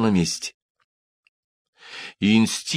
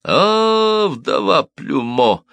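long high "А", then slower name; exclaiming; 10 semitones higher, much slower than usual. A narrator's reading. Cobbler threatening the widow, exclaiming. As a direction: stretch the cry high, then drop onto the name. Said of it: "воскликнул Симон".

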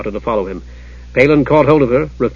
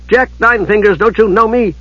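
higher-pitched urgent appeal; calling out; 8 semitones higher than usual. A narrator's reading. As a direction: appeal to him in a raised pitch. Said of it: "called out Usha".

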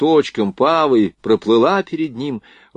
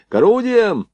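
high-pitched call to arms; ringing military order; loud command; 11 semitones higher, 4 dB louder than usual. A narrator's reading. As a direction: shout the crew to their guns. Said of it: "громко скомандовал он".

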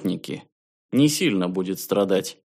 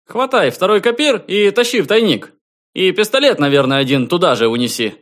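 high, loud and eager; bursting with delight; excited; 9 semitones higher, 6 dB louder than usual. A narrator's reading. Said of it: "чуть не прыгая от восторга".

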